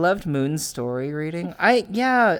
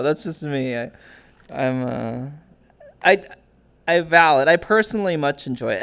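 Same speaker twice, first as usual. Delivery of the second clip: ordinary talk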